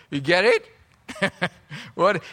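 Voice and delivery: ordinary talk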